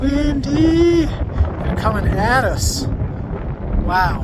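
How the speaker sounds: excited. Real, not acted. Wind gusts on the summit.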